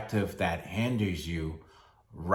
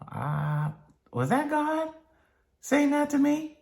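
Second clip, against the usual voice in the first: very high falsetto mock doubt; wavering doubter. Doubter second-guessing God's voice, high mock puzzlement. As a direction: squeak it like an unsure believer. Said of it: "You don't have to say".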